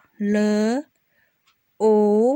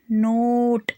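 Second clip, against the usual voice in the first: each word said slowly and clearly, with a pause after it; word list drill; much slower than usual. Shown as a demonstration. Teacher reading practice words for children to repeat, slow and clear. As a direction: model every word for the class to copy.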